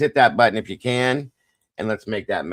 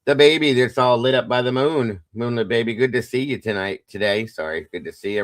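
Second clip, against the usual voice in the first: ordinary talk, nothing marked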